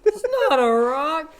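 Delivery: squeaky teasing voice; mock it in a high squeak. Mocking the excuse.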